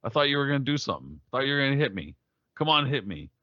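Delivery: high, fast teasing taunts; taunting boxer. Retold or imitated. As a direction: needle the opponent, quick and high. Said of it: "going like".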